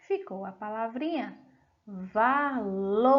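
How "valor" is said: "valor" as va, then a long lor; slow dictation of "valor" for pupils; syllable by syllable. Shown as a demonstration. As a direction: stretch and separate the syllables of "valor". Teacher sounding out changed word.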